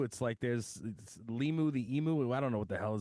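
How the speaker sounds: ordinary delivery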